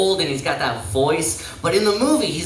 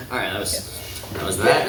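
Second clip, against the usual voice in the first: ordinary talk, nothing marked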